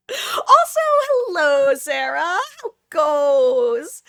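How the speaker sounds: high, loud hello to a regular; excited greeting. Real, not acted. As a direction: call out a drawn-out, delighted welcome. Calling out a greeting to viewer.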